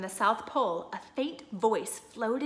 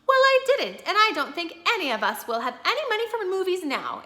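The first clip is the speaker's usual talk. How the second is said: high, louder, sharp retort; a little sharply; 10 semitones higher, 6 dB louder than usual. Acted. Wife scolding husband about money, sharp and high. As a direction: snap back about money, pitched up. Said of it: "a little sharply".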